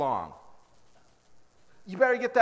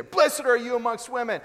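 a loud higher burst; sudden blurt; 5 semitones higher, 5 dB louder than usual. Pretend. Elizabeth blurting her blessing at Mary, loud outburst. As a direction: fire it off suddenly. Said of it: "probably like Tourette's".

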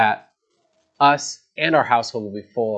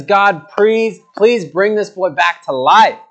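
higher, louder, slower begging; desperate plea; 6 semitones higher, 5 dB louder, slower than usual. Acted. Prophet begging God a second time, louder and higher. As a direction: plead with God, pressing harder. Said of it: "He says".

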